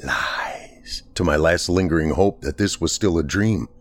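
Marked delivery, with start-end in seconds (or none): 0.0-1.0 breathy whisper, much softer; a hushed, unvoiced hiss of the word. A narrator's reading. The door whispering to his last hope, hushed. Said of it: "the wooden door ... whispered".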